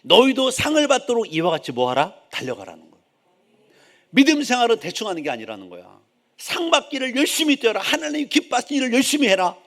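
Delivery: loud, high exhortation; full-force preaching. Real, not acted. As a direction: drive the point home to the congregation.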